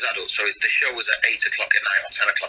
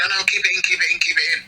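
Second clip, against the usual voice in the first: higher, insistent "keep it in"; eager urging; 8 semitones higher, faster than usual. Retold or imitated. Ricky Gervais urging the actor to keep the line in, eager. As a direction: urge him on, delighted.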